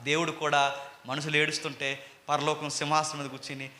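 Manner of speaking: ordinary delivery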